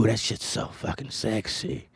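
low, soft, slow lusty murmur; turned-on, hushed and lingering; smitten swooning. Retold or imitated. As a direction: swoon over it, soft and drawn out. Retold smitten reaction to accent.

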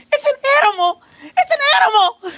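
very high, squealed cry of alarm; mock panic. Diner alerts the guide to a creature, hammed-up high panic. Retold panic at supposed hyena.

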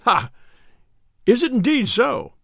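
keen, excited questioning; eager cry. A narrator's reading. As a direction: jump eagerly at the news. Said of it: "cried the tinker eagerly".

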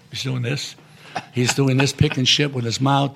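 ordinary talk, nothing marked

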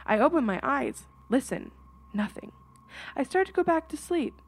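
plain reading voice

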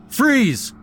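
high, loud police command; commanding shout. A narrator's reading. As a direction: call out sharply to halt a crowd. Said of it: "called a voice from the trees".

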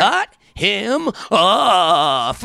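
very slow, drawn-out "Cut him off" in a put-on voice; mock breezy dismissal. Mocking the friends' advice again, slow and showy. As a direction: toss it off like a game show host.

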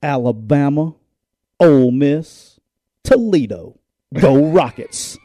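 slow, dramatic mock commitment reveal; hammed-up slow recruit video announcement. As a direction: play the recruit announcing his picks with gravity. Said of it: "He goes".

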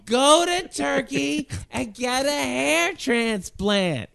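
very high, slow mock heckler; imitated drunken high-pitched call; drunk heckler yell. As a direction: draw it out like a drunk girl hollering. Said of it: "girl in the corner who's drunk yells out".

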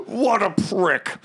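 high, slow, savoured words; hearty blowhard. Acted. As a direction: relish the insult after a big laugh. Blowhard recovering from laughing.

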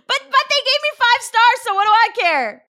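loud, high-pitched playful protest; mock-defensive protest. Real, not acted. Host defending five-star review to co-host, mock-defensive.

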